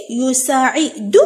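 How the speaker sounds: يساعد slow, stretched into يو، سا، عي، دو; syllable by syllable. Shown as a demonstration. Teacher breaking the verb up for pupils, syllable by syllable. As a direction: sound out يساعد slowly in separate syllables. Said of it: "راح نقطع كلمات الدرس".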